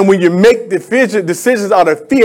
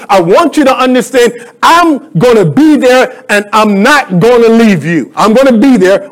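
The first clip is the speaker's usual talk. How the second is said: raised, insistent sermon voice; impassioned preaching; 5 semitones higher than usual. Acted. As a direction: drive the promise home phrase by phrase. Preaches at full force.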